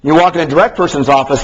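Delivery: usual speaking voice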